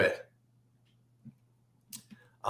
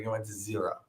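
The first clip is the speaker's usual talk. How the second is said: re-enacted hushed payoff; quiet confrontation; 2 semitones lower, 10 dB quieter, faster than usual. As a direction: say it softly, straight into his eyes. Acted confronting him, eye to eye.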